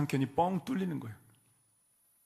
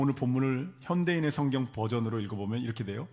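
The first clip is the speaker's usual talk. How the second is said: ordinary talk, nothing marked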